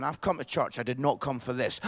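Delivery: low mock grumble; grumbling churchgoers. Preacher imitates people who did not come for this, low grumble. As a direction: lightly voice an unwilling congregation, pitched low. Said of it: "People are going".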